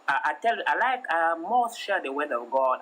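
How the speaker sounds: usual speaking voice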